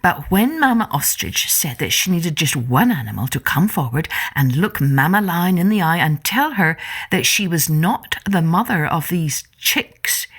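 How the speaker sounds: ordinary delivery, nothing marked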